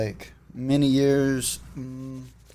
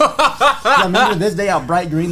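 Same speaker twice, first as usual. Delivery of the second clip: laughing; 7 semitones higher, 8 dB louder, slower than usual. Real, not acted. Laughing recalling bright green weed.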